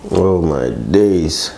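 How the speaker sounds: ordinary delivery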